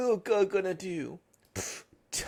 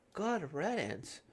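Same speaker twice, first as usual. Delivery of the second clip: normal talking voice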